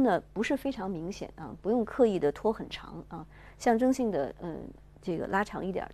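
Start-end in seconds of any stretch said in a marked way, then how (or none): none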